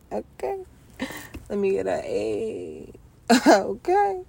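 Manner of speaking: laughing high through the words; can't stop laughing; giggling through a retake. Real, not acted.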